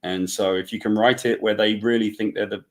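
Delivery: usual speaking voice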